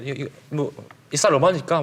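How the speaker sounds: North Korean market speech; North Korean accent. Retold or imitated. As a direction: ask the price in North Korean dialect. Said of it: "예전에 뭐 북한 말투로".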